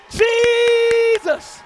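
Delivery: loud, high call of the name; preaching shout. Real, not acted. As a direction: lead the church in the name. Leading congregation in the name.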